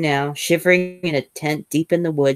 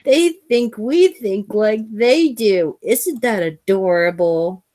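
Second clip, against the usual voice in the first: higher, slow, mocking alien; lightly voiced alien mocking humans; sneering alien voice; 6 semitones higher than usual. Alien narrator voice at the opening.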